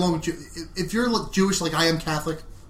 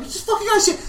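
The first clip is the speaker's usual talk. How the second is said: high mocking voice; faster than usual. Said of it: "Kaz's like".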